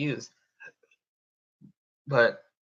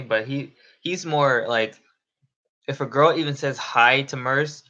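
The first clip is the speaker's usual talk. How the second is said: ordinary talk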